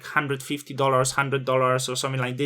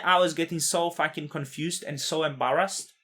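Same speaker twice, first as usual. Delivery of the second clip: usual speaking voice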